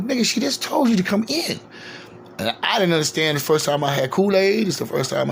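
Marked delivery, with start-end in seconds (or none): 0.0-1.6 higher, casual impatient friend voice; friend calling him in. Retold or imitated. Richard waving his friend inside, high, casual and impatient. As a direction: toss it off like a buddy at the door. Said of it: "He goes".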